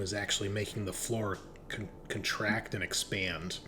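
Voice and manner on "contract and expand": usual speaking voice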